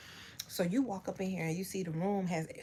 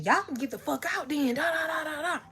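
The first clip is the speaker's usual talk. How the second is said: higher, louder angry yell; mock yelling; 9 semitones higher, 3 dB louder than usual. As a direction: yell like the furious landlord. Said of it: "He starts yelling".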